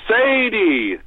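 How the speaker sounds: high, drawn-out holler; yell from upstairs. Retold or imitated. Ailing Wyatt calling Sadie from upstairs, high yell. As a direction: call out loud and high to someone downstairs. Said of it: "and he'd yell".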